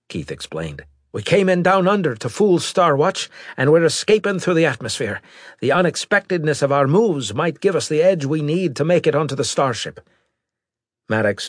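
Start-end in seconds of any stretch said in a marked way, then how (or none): 1.1-10.0 higher, animated character voice; eager pilot. Acted. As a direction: explain the plan to the captain, pitched up. Pilot's voice explaining plan.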